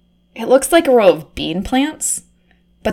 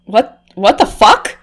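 usual speaking voice

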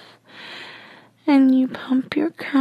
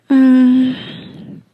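satisfied moan; 6 dB louder than usual. Acted. Satisfied hum after climax.

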